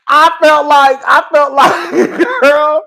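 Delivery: loud, high laughter through the words; laughing. Real, not acted. Recalling Kenya losing it, loud laughing. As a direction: crack up as you say it. Laughing hard at her memory.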